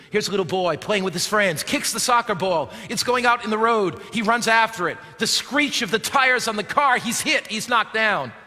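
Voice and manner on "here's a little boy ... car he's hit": ordinary talk, nothing marked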